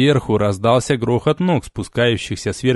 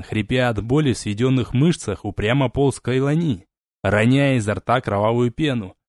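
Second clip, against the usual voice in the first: plain reading voice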